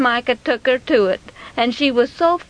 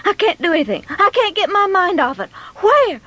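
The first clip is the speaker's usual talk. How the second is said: raised, worked-up complaint; frantic mother; 6 semitones higher, faster than usual. Acted. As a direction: act out a mother at her wits' end. Frantic mother searching for money.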